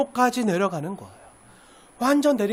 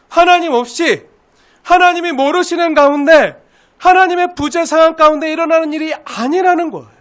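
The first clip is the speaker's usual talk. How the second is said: higher, louder rising proclamation; preaching at full force; 4 semitones higher, 6 dB louder than usual. Acted. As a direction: build it up to a ringing climax. Climax of the sermon. (힘차게 외치는 설교)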